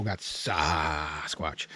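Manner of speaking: very low, slow growling monster voice. Acted. Vlogger introducing his chainsaw Sasquatch, deep slow monster growl. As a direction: drop way down and growl the saw's name.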